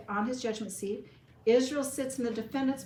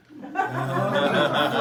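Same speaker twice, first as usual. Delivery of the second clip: big burst of laughing; loud, real laugh; loud laughter; 2 semitones lower, 11 dB louder than usual. Real, not acted. Group reacts to God part.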